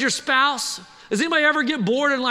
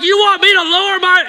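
hammed-up shout, much higher pitch; loud person; 8 semitones higher, 10 dB louder than usual. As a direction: blare it out, mimicking someone who only gets louder. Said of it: "they just get louder".

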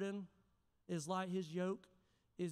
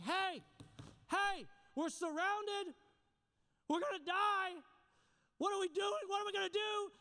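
high, loud panicky cry of alarm; a frightened servant waking his master in a rush; panicked servant; 9 semitones higher, 3 dB louder than usual. Retold or imitated. Servant wakes Elisha, surrounded by the enemy army, in panic. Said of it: "he's totally in flip out mode".

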